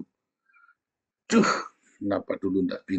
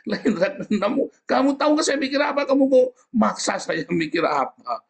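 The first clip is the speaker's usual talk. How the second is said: indignant retort; 4 dB louder than usual. Retold or imitated. Preacher retorts loudly.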